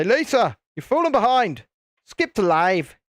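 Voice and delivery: calling out. Acted. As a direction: call out to someone who isn't keeping up. Calling out to viewer behind stream.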